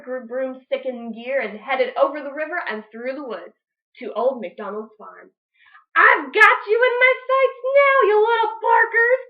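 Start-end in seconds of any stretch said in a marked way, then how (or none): 6.0-9.2 high, loud sing-song gloating; cackling witch. Acted. Witch Grinch cackling at the pigs from above. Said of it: "she cackled".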